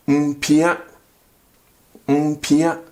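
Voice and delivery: Mpia with a hummed m before pia; Mpia, a nasal m glided into pia; say the negative form. Shown as a demonstration. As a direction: Twi word Mpia said clearly. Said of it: "Only this prefix".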